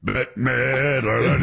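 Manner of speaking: slow, stretched Bette Midler; drawn-out Bette Midler. Shown as a demonstration. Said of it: "sounded like you said ... [name]".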